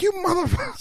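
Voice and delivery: squeezed high comic protest; mock outrage. Retelling woman's drink thrown at him.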